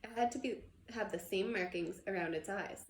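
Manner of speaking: usual speaking voice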